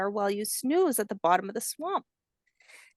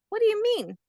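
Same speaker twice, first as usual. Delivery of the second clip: much higher, a small puzzled voice; curious hatchling; 11 semitones higher than usual. Acted. Hatchling asks grandmother what she means, high childlike voice.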